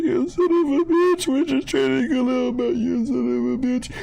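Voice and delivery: high-pitched hammed-up sobbing curse; mock crying. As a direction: whine it like you're crying at work. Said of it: "I was at work crying".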